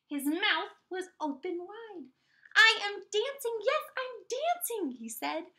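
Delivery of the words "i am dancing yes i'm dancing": high, loud joyful exclaiming; joyful cry. Acted. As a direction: shout it with wonder. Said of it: "Gerald cried".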